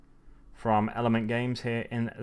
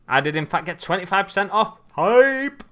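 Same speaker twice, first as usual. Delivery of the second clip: loud, high celebration building to a shout; hyped-up announcement bursting into a shout; excited hype; 8 semitones higher, 10 dB louder than usual. Real, not acted. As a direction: crow about it, ending in a big high yell.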